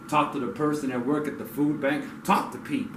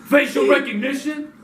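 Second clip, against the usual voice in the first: scornful; 9 semitones higher than usual. Real, not acted. Scornful rhetorical question.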